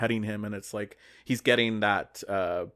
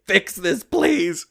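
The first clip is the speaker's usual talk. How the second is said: high, loud imploring plea; pleading signal; 9 semitones higher, 7 dB louder, much slower than usual. Retold or imitated. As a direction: beg him to fix it, pitch climbing up. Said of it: "doing everything she can to signal to Mike".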